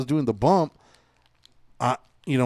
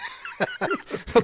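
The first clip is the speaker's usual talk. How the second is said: high laugh. Real, not acted. Laughs at mock family.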